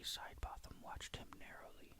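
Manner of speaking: usual speaking voice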